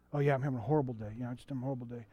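put-on low, soft voice of a downcast kid; imitating dejected boy. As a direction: mumble it quietly as an unhappy child. Retold boy having a bad day.

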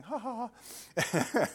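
high, light mock ha-ha-ha; imitated laughter. Fallen driver laughing on the ice, imitated laugh. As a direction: act out laughing it off. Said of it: "And I'm laughing".

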